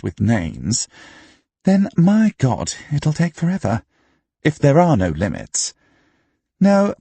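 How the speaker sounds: high character voice, emphatic; opinionated pub debater. A narrator's reading.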